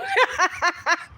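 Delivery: high cackling laugh. Real, not acted. Hotel worker joking about rude customers, cackling laugh. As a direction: burst out laughing at your own joke.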